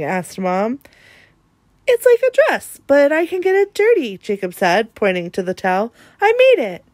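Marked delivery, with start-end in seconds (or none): 1.9-4.2 lightly voiced little boy, much higher and louder; eager child. A narrator's reading. Jacob showing Mom his towel dress, eager. 6.2-6.8 put-on proud child. A narrator's reading. Jacob proud of towel dress.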